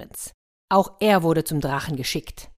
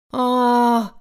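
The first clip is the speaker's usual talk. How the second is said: high, loud lament; a despairing wail; 7 semitones higher, 6 dB louder than usual. A narrator's reading. Tired prince lamenting his fate, loud wail. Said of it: "jammerte ein anderer".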